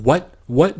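retelling the furious caller's confused snap; imitating angry disbelief. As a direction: throw back an outraged double "What?" like the caller did. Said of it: "he was, like, super pissed".

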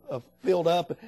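ordinary talk, nothing marked

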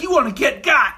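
high, loud mock pep talk; hype-man boss. Branch head pumping up his new hire, loud and cocky. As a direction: fire it at the recruit like a hustler. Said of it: "He's like".

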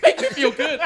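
high-pitched mock pleading; Halle Berry. Host pretending to be the actress for co-hosts, mock desperate plea. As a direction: beg loudly in a hammed-up film-scene plea. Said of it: "pretend I'm Halle Berry".

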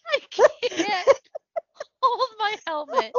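high, laugh-choked repeating of a joke; helpless laughter. Real, not acted. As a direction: try to get it out while cracking up. Cracking up while repeating the joke.

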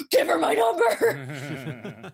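slow, high, half laughing half crying; laughing desperate plea. Retold or imitated. Shelley begging the receptionist, slow and laughing. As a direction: beg through laughter.

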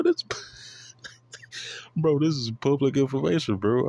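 breathy laugh into high retort. Real, not acted. Teasing critics, a laughing high public information. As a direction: laugh it out, half whispering.

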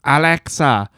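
high, exaggerated Irish accent; imitated Irish woman. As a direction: mimic an Irishwoman commanding a voice assistant. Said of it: "the video of the Irish woman".

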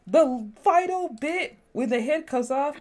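ordinary talk

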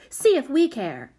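high, careless retort; dismissive shrug. A narrator's reading. Prudence brushing off the child's threat, dismissive. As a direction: throw it back, not caring. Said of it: "said Prudence with a shrug".

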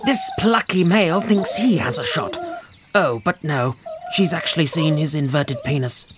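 hushed wildlife presenter voice; nature documentary narration. Acted. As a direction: narrate a spoof nature show.

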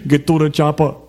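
Schwarzenegger impression. Schwarzenegger quote.

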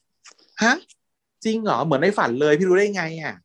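high, eager gasp of delight; thrilled like a child handed a new toy; excited like a kid. Retold or imitated. Ton hears the day of his death, answers like an excited kid. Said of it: "ทำเสียงแบบตื่นเต้นเหมือนเด็กได้ของเล่นนะ".

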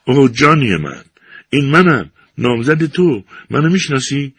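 high, loud lovesick cry. A narrator's reading. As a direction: call out to him desperately. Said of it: "فریاد زنان به محبوبش گفت".

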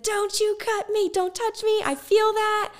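high, piercing scream of panic at the surgeon; retold scream. Mother on the operating table screams at the doctor, high and panicked. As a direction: shriek it out as the scalpel touches. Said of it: "screamed at him".

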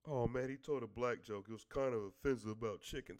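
low-pitched mock grievance; mock offended complainer. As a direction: drop way down in pitch to mimic someone offended. Mimics someone offended by a joke.

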